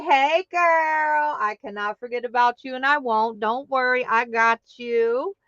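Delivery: ordinary talk, nothing marked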